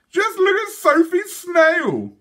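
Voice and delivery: high, loud, slow mocking hoot. A narrator's reading. Twins mocking their little sister's snail, hooting. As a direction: crow at her tiny snail. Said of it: "hooted the twins".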